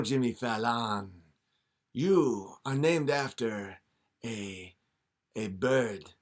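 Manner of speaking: a hint of a scheming devil; devil tempting. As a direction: coax him like a smooth devil. Devil makes deal.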